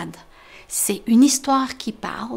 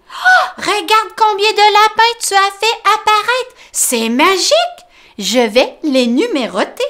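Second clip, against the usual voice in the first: high, loud happy mole; Tom delighted; 10 semitones higher, 6 dB louder than usual. Acted. Tom to Grumbo about the rabbits, high happy voice. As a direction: count the treasures gleefully.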